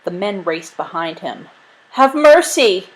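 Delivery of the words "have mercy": high, loud begging cry; yelled plea. A narrator's reading. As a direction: beg loudly for mercy. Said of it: "he yelled".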